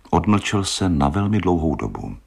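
usual speaking voice

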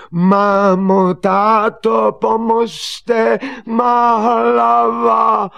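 very high, loud, drawn-out cries; full-throated panicked shout; screaming for help. Acted. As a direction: yell at the top of your lungs. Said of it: "křičel, co hrdlo stačilo".

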